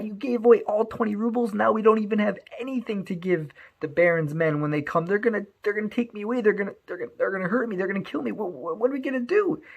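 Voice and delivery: high, rushed panic; panicked outburst. Acted. Hirsch panics at his wife over the rubles, frantic. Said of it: "Hirsch is screaming and he's freaking out".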